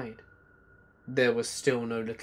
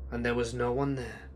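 plain reading voice

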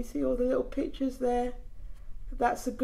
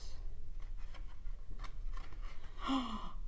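gasp; 11 dB quieter than usual. Acted. Reading a baby book aloud.

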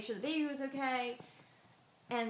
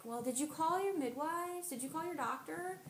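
higher, lightly voiced brisk nurse; a hint of a curt hospital nurse; nurse on phone; 7 semitones higher than usual.

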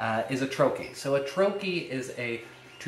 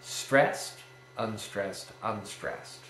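first word "stressed" firm, both "unstressed" dropping away; dactyl stress pattern; 3 semitones lower, much slower than usual. Shown as a demonstration. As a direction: slowly demonstrate stressed, unstressed, unstressed as one strong beat and two weak. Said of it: "a dactyl is stressed".

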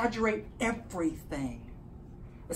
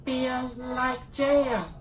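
alien voice; 4 semitones higher, 3 dB louder, much slower than usual. Acted. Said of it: "I got my alien voice".

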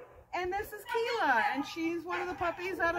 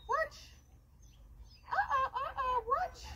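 high coaxing; 8 semitones higher, 5 dB quieter than usual. Real, not acted.

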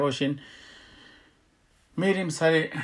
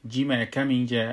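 normal talking voice

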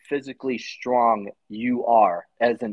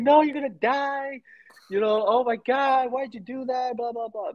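retold crying mom; 12 semitones higher than usual. Mom learning her son enlisted, imitated high crying plea. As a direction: mimic his mom pleading through tears, pitched way up. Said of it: "She's, like, crying".

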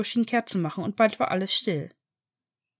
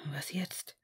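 hushed whisper, much softer; 5 semitones lower, 13 dB quieter than usual. A narrator's reading. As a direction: breathe it so nobody hears. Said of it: "flüsterte Ron".

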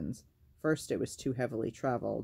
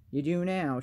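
louder, higher, snappish comeback; angry retort; 5 semitones higher, 5 dB louder than usual. A narrator's reading. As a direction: throw it back at them, irritated. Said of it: "She sounded angry".

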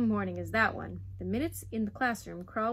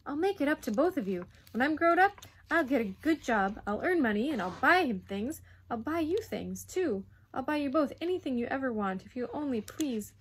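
put-on raised, urgent boyish rush; racing promises; 5 semitones higher, 6 dB louder than usual. Boy promises both parents gifts, words racing. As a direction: tumble out promises, easing toward the plea. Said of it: "words began to race from his mouth quickly".

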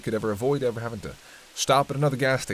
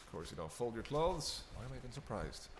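muttering to himself; 2 semitones higher, 14 dB quieter than usual. Acted. Muttering while digging in bag.

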